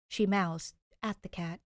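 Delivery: ordinary delivery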